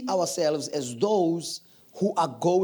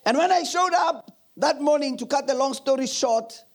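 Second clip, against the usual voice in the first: normal talking voice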